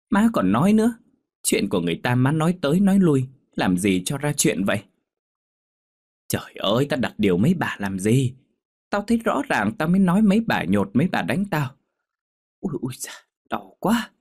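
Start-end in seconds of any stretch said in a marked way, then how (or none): 0.1-4.8 high, loud, quick reproach; exasperated scolding. A narrator's reading. Daughter reproaching her mother about gossip, exasperated. 8.9-14.1 put-on high old woman's voice wincing in pain; protesting old mother. A narrator's reading. Bruised mother defending herself to her daughter, wincing.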